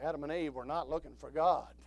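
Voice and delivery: normal talking voice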